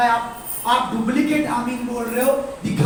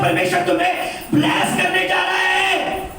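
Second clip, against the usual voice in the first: loud blessing proclamation; 7 semitones higher, 4 dB louder than usual. Shown as a demonstration. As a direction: raise the voice high and proclaim the blessing. Preacher models blessing loudly.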